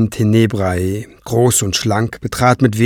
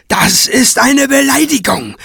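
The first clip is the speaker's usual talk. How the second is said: shrieking; 13 semitones higher than usual. Acted. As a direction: screech in outrage. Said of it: "kreischte Craven".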